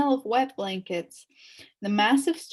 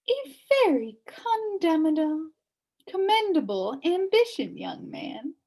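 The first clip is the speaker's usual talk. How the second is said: high put-on old lady voice; an old lady in a cell praising Milo; old witch lady; 8 semitones higher, 3 dB louder than usual. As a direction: praise the boy primly. Witch figure speaks.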